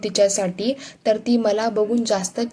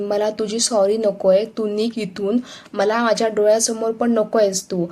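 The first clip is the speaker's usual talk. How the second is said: ordinary delivery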